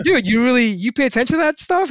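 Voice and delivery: incredulous, half laughing at him; surprised disbelief. Retold or imitated. Surprised at friend's attention.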